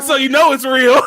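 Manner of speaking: loud, high, laughing delivery; laughing hard. Real, not acted. Panelist capping his own joke, loud and laughing. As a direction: crack up while getting it out.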